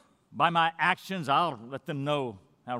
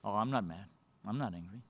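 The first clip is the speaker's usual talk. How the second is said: low, quiet, mock-innocent denial; 8 semitones lower, 8 dB quieter than usual. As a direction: play the wimp brushing it off softly. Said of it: "much easier to be a wimp and just".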